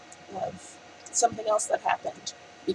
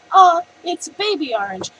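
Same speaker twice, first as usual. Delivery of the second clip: high, loud delighted coo; cutesy baby orange; 10 semitones higher, 10 dB louder than usual. Retold or imitated. Retelling her own squeal over an orange slice. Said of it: "The way you said that was so funny".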